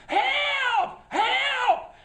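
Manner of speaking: high, loud screams for help; loud, high panicked screams for help; screaming for help. Retold or imitated. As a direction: scream it as you run from the wolf.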